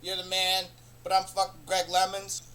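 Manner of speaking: usual speaking voice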